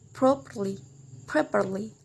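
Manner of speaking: "properly" stressed on "prop-"; first-syllable stress. Shown as a demonstration. Teacher gives an -ly example, stressing "prop-" in "properly". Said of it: "put in the first syllable".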